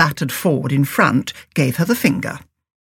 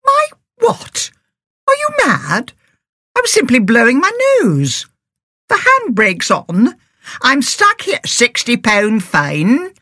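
much higher, shrill-edged indignation; outraged disbelief; 8 semitones higher than usual. A narrator's reading. Driver ticketed by a policeman, outraged and disbelieving. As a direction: protest as if he's lost his mind.

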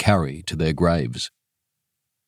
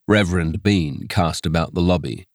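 usual speaking voice

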